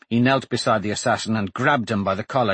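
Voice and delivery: usual speaking voice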